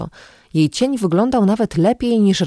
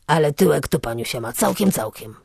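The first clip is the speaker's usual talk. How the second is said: leer and let it fade; leering vagrant. A narrator's reading.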